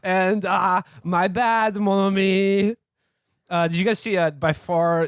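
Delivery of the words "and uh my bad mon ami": higher, exaggerated French worker; French accent. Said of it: "now here's my bit".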